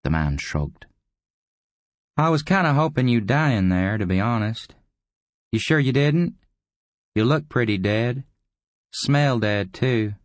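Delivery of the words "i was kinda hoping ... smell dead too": high-pitched, taunting character voice; higher, casually mocking visitor; needling visitor. A narrator's reading. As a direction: needle the old man in a higher voice.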